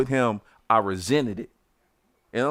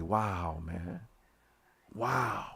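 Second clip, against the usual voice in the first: much softer, disbelieving "wow"; stunned quiet wow; 3 semitones lower, 10 dB quieter, much slower than usual. Retold or imitated. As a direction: let the shock sink in quietly. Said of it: "I say".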